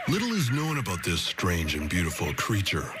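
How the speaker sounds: ordinary delivery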